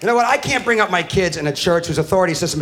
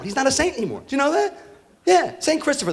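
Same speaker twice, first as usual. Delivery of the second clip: ordinary delivery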